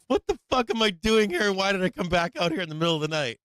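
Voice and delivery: high, loud panicked yelling at himself; panicked outburst. Retold or imitated. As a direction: relive the panic, voice shooting up high. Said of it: "and I'm like".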